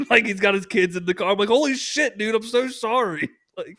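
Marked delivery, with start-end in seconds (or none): 1.5-3.3 retold high-pitched embarrassed apology; mortified apology. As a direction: act out apologizing in a fluster, pitched way up. Retold mortified apology after swearing.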